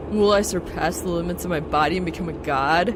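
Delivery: loud, grand mock-heroic voice; dramatic declaration. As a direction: declaim it like a hammed-up hero. Over-the-top text message read aloud.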